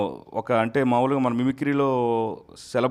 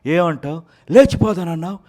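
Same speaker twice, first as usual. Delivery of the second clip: much higher and louder teasing outburst; Nagarjuna film dialogue; 10 semitones higher, 12 dB louder than usual. Pretend. Mimic voices a Nagarjuna film taunt, much higher and louder. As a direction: build to a shrieking, loud taunt. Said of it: "Nagarjuna గారు voice".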